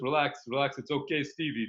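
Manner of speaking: ordinary talk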